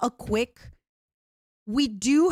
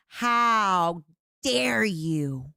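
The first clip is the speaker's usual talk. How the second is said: ordinary delivery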